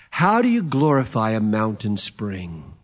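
ordinary talk

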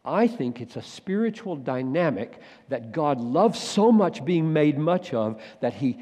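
ordinary delivery

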